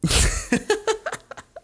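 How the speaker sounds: high-pitched burst of laughter; real laugh. Real, not acted. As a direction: laugh at your own awkward joke. Laughs at own segue.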